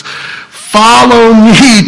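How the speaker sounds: very slow, high, stretched-out call; drawn-out call. Retold or imitated. Quoting Jesus' call.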